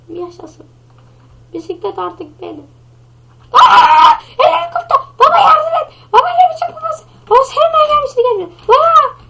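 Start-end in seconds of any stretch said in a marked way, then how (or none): 3.5-9.2 put-on high, loud child yelling; a boy squealing for dad's help; panicking kid. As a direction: kid screaming to his father in the race.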